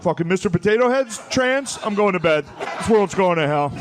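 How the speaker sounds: high, loud outraged rant; angry dad. Acted. Dad storms off to bed after Fox News, angry outburst. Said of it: "until they get so angry".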